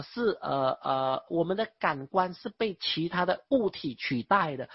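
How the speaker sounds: ordinary talk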